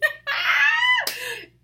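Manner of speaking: high squealing laugh that rings out; loud high laugh. Real, not acted. Speaker joking that she can't help being hyper, loud high laugh.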